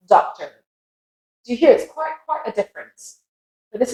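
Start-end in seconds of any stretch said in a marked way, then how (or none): 0.1-0.5 American doctor with a final R; doctor with open "ah" and R, American; American accent. Shown as a demonstration. Teacher contrasting doctor with British, American accent. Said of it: "and as an American accent".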